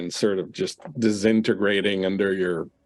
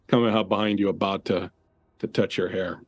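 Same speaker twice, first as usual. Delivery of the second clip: ordinary talk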